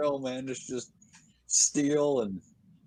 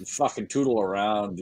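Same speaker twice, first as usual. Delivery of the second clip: normal talking voice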